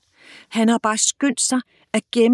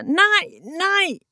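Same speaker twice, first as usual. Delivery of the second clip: screaming no; 10 semitones higher than usual. Acted. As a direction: scream it out of a nightmare. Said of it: "og skriger". (skrigende panisk råb)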